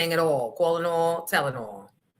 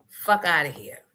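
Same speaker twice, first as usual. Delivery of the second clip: usual speaking voice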